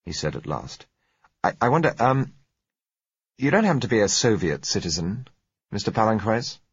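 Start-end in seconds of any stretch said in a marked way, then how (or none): 1.4-6.6 halting, raised-pitch probing question; a hint of a wary businessman fishing for the truth; hesitant director. A narrator's reading. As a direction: director hesitantly asks the seller, higher.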